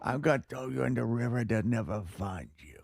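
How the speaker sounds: put-on low, soft old mobster growl; Italian American mob threat, quiet; mob accent. Shown as a demonstration. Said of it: "not a mob".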